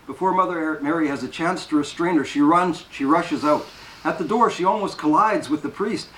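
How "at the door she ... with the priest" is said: plain reading voice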